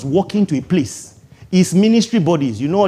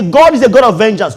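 loud declaration; 5 semitones higher, 6 dB louder than usual. Shown as a demonstration.

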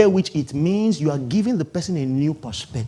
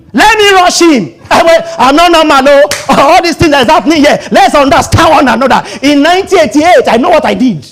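high, loud, excitable hothead; hammed-up deacon being held back; mock deacon itching to fight; 10 semitones higher, 9 dB louder than usual. Deacon held back from a fight, shouting.

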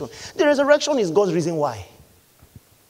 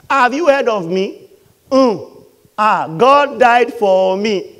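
lightly swaggering, louder and higher; acted boasting; 3 semitones higher, 6 dB louder, slower than usual. Preacher playing a believer telling the world God died for them, acted boasting. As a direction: show off with drawn-out, emphatic pride.

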